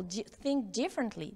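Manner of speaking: ordinary talk, nothing marked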